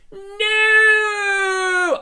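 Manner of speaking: a high, loud dramatic scream of no; yell. Acted. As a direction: scream it like a daughter seeing her father fall. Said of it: "I yelled".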